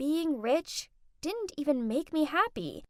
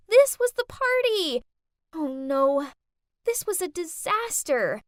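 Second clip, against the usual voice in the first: high, loud panic; panicked realization; 4 semitones higher, 5 dB louder than usual. A narrator's reading. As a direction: gasp out the realization. Realizes disaster.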